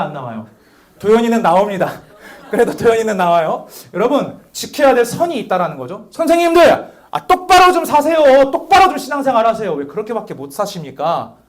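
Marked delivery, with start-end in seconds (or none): 6.1-11.4 high loud rebuke at the teachers; mock scolding shout. Shown as a demonstration. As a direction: scold them loudly, half in jest. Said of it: "한다면 우리의 관계는".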